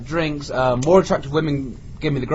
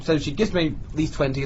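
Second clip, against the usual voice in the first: usual speaking voice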